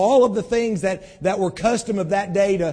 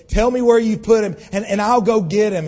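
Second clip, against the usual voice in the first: louder, higher and pleading hard; a grieving woman crying out, louder; desperate plea; 5 semitones higher, 8 dB louder than usual. Acted. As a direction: beg the gardener to give him back. Mary begs the supposed gardener.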